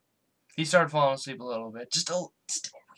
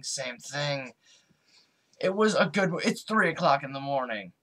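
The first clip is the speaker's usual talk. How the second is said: normal talking voice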